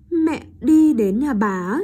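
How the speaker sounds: loud, slow "Mẹ đi đến nhà bà" posed as a question; questioning intonation. Shown as a demonstration. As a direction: turn the statement into a question on "nhà bà". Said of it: "lên giọng ở cuối câu". (nói to, hỏi lại ở "nhà bà")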